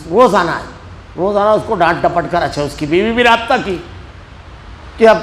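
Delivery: ordinary delivery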